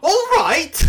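high, loud exasperated yell; over-the-top indignant outburst; mock strop. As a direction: shout it in a hammed-up huff. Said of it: "She really gets a strong one".

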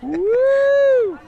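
very high, loud whooping hoot; loud high woo. Pretend. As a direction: whoop it out like a howling wind. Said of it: "I keep hearing this wooing noise".

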